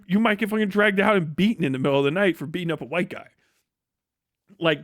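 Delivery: usual speaking voice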